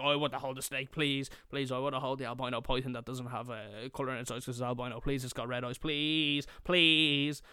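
put-on rushed kid's plea building to loud pleases; eager begging. Eager kid begs to hold snake.

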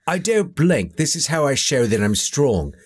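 bad mock Cockney actor impression; Michael Caine. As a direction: put on a hammy London accent. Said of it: "The bad Michael Caine".

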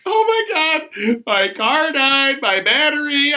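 high, drawn-out mock distress; imitated distressed woman. Acting out stranded woman in distress.

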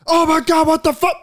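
imitating an angry enemy in death chat; mock enraged yell. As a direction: shriek like a mad player. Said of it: "They're like".

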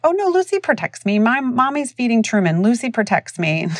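put-on high, innocent kid voice; little boy. Boy reassures neighbor about his dog, imitated high child voice. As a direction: imitate a small child explaining himself, high then easing. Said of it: "He was like".

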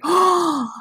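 high, gasping oh of shock; a stunned mom's oh; gasped high oh. Retold or imitated. Mom sees the reviews.

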